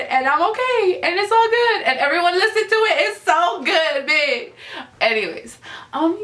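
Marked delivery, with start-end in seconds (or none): 0.0-4.5 high, over-the-top gushing; going overboard, excited. Real, not acted. Fan telling everyone to listen, excitedly overboard. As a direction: pitch it way up, carried away. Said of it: "I'm going overboard".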